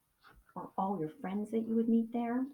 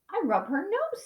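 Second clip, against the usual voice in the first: put-on little girl's voice; 9 semitones higher than usual. A narrator's reading.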